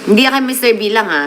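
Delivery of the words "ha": ordinary delivery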